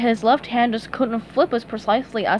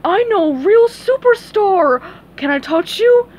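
higher, louder goofy fan; starstruck starfish; 9 semitones higher, 7 dB louder than usual. A narrator's reading. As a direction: gush like a groupie. Patrick voiced.

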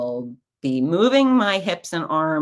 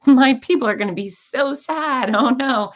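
high-pitched, louder melodramatic wail; mock lament; 9 semitones higher, 6 dB louder than usual. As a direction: lament like a hammed-up tragedy. Mock dismay, imagines disappointed listeners.

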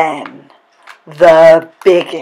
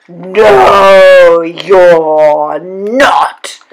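loud, drawn-out roar; troll's roar; 7 dB louder, much slower than usual. A narrator's reading. Troll to the little billy goat, roaring. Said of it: "roared the troll".

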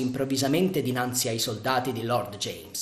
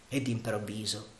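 plain reading voice

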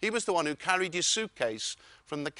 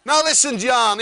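loud friendly; 6 semitones higher, 11 dB louder than usual. Retold or imitated. Wayne on the phone.

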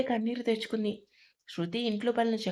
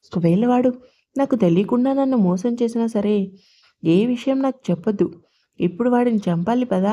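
louder, furious narrated shout; narrated angry yell; 10 dB louder than usual. A narrator's reading. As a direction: yell it out in a mother's rage. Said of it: "అంటూ అరిచింది".

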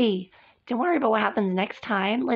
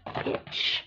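whispered insult; 9 dB quieter than usual. Acted. Muttered insult.

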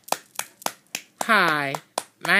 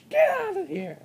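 silly high voice; 10 semitones higher, 3 dB quieter, much faster than usual. Pretend. Kid shooing off playmate.

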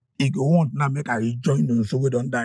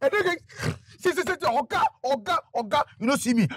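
frantic high begging; 12 semitones higher than usual. Acted.

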